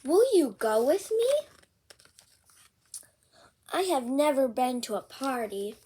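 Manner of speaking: lively picture-book read-aloud; bouncy storybook reading; reading to children. Acted. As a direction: read it out with big character voices for a kid.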